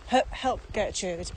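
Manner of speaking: ordinary delivery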